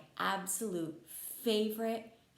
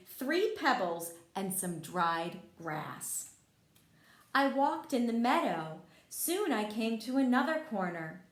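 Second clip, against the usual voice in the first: bright, lively storybook reading, louder and higher; reading to children; 3 semitones higher, 5 dB louder than usual. A narrator's reading. Teacher reading Frog and Toad to kids, lively storybook voice. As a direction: read it out like a teacher at story time. Reads picture book to children.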